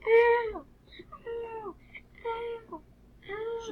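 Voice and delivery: high yelping ow ow ow; hammed-up hurt yelps; mock cries of pain. Pretend pain after being hit.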